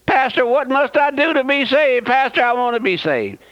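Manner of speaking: high-pitched imitation of a desperate seeker; pleading convert. Preacher imitates a churchgoer begging the pastor for salvation, high and pleading. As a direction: act out a churchgoer's high, urgent plea. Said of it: "Have you ever heard that happen in your service".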